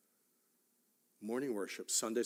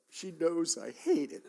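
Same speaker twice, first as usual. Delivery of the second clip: ordinary talk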